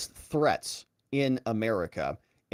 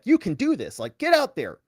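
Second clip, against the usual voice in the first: higher, hammed-up coach firing up his player; mock coach pep talk; 7 semitones higher than usual. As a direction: pump him up like a sideline coach. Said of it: "back to his coach and he's like".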